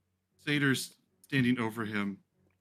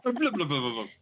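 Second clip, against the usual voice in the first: high mock gurgle; imitating underwater burbling; shouting underwater; 4 semitones higher, 3 dB louder, faster than usual. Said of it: "you are in the water right now".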